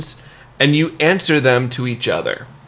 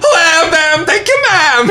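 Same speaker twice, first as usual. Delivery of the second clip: absurdly high mock star voice; 4 dB louder than usual. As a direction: push a bad impression into falsetto. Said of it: "nail your [name] impression".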